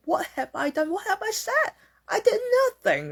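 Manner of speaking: very high-pitched protest of innocence; hammed-up incredulous questioning; bewildered protest. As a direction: imitate someone wronged, voice climbing high. Voicing the innocent, questioning listener.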